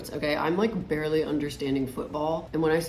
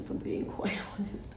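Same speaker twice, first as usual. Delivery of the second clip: laughing whisper; 5 semitones higher, 5 dB quieter than usual. Real, not acted. Laughing aside about saying um.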